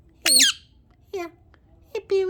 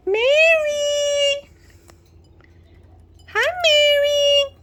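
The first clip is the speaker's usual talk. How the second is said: calling out; 7 semitones higher, 4 dB louder, much slower than usual. Real, not acted. Calling bird by name.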